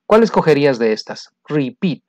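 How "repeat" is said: "Repeat" accented on -peat; stress on second syllable. Shown as a demonstration. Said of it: "En la segunda". ("Repeat" acentuado en la segunda sílaba)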